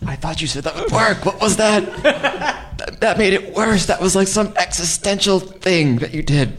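high, loud exasperated rant; squeaky disbelieving protest; exasperated complaint. Acted. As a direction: throw up your hands at your friend.

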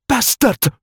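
woman curses her lover in tears; spat. A narrator's reading. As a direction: spit the word at him. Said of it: "she spat".

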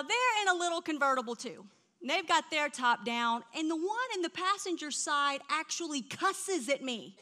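normal talking voice

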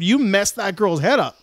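ordinary talk

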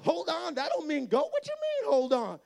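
high, outraged protest; indignant disbelief. Retold or imitated. Little brother protesting the false start, high and indignant. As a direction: squeak it out in disbelief. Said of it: "And I'm like".

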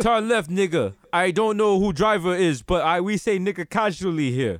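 put-on Russian-accented GPS navigator; mock Russian knockoff of a street guy; stolen-voice Russian accent. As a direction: give directions in a comic Russian accent. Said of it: "they have your accent".

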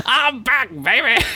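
put-on high, hyped "I'm back, baby"; gleeful comeback cry. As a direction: shout it like a triumphant return. Mock George Lucas comeback.

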